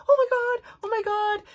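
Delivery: very high, squealed repetition; retold frantic suspense. Mom waiting by the gate, frantic squeal. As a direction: squeal it like you can hardly bear it.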